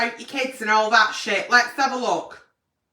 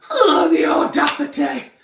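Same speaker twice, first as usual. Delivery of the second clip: mock outrage. Real, not acted. Outraged at cheating partner's cheek.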